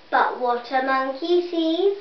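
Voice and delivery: ordinary delivery, nothing marked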